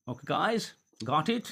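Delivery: usual speaking voice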